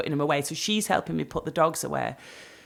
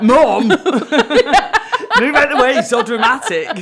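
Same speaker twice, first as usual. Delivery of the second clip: put-on a louder, laughing take on an exasperated teen daughter; 7 semitones higher, 9 dB louder than usual. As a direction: laugh through a teen daughter telling mum off. Daughter scolding mum in story.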